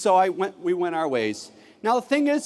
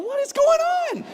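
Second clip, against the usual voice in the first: put-on puzzled kids. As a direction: act out baffled classmates. Kids puzzled by teacher's pattern.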